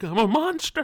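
squeaky high wail of despair; mock cry of a tortured monster; hammed-up anguish. Derek whining to himself, a squeaky mock wail. Suffering Derek, retold melodrama.

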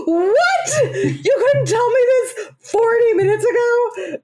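high, loud, laughing outrage; laughing mock outrage. Customer laughing as she retells her outburst at the KFC counter. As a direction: replay the outrage while cracking up.

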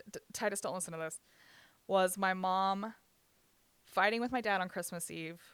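ordinary talk

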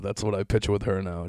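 ordinary talk, nothing marked

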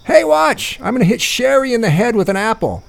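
high excited yell across the yard. Retold or imitated. Teen boy calling to grandma across the yard, high excited yell. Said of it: "I yell at Grandma DeLutri".

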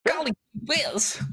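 a hint of a gosh-darn fifties innocent; 1950s gee-whiz voice. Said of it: "is that what the fifties is".

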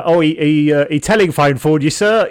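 a put-on Cockney butler; stagey dub-style Cockney; fake Cockney. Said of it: "you've never heard an actual Cockney".

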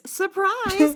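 high, drawn-out "Surprise!"; mock announcement. Reading the first episode title aloud, drawn-out and high. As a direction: sing it out like a game show reveal.

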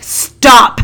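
sharp loud shout at a man cutting the line; shouting stop. Retold or imitated. Woman yells at man pushing past on plane, loud shout. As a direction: bark it out loud. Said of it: "and I'm like".

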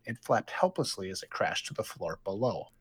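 usual speaking voice

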